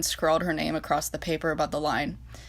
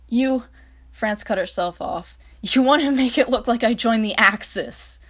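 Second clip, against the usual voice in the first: upset outburst; 5 semitones higher, 6 dB louder than usual. Acted. France confronts Reich, cut off.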